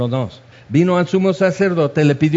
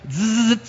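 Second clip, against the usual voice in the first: buzzing "zzz" like a doorbell; sudden buzzer sound cutting in; imitated doorbell buzz; 4 semitones higher than usual. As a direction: make a doorbell noise with the mouth. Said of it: "suena el timbre".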